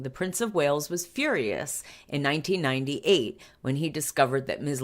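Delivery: ordinary talk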